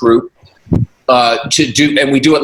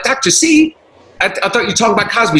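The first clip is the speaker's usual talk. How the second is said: baffled, fast double take on the name; surprised; 7 semitones higher than usual. Retold or imitated. Told his nickname is wrong, he asks back, surprised. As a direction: pitch jumps high in confused surprise at "Doctor C?". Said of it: "I'm like".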